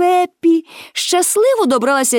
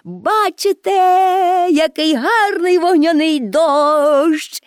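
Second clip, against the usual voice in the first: high, long-drawn cry of delight; rapturous exclamation; 5 semitones higher, slower than usual. A narrator's reading. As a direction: marvel aloud, stretching the words in wonder. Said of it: "і захоплено вигукувала".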